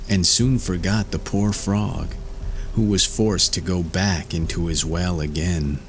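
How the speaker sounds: plain reading voice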